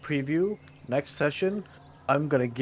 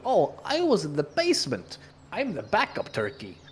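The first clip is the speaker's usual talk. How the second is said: higher, goofy stranger voice; silly stranger voice; 5 semitones higher than usual. Retold or imitated. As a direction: play it as a silly newcomer.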